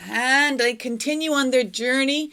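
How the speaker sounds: usual speaking voice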